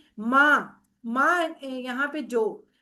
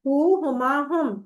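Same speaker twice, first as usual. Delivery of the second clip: each pronoun spaced out slowly; slow recitation; much slower than usual. Shown as a demonstration. Teacher drilling Arabic pronouns for students, slowly. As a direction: lay out the three words slowly like a drill. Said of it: "اس کو ایک دو دفعہ آپ دیکھ لیں".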